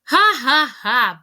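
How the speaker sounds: loud, spelt-out villain's ha-ha; acted laugh. A narrator's reading. Botticelli enjoys the fight from the sidelines, laughing. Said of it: "Botticelli laughed from the sidelines".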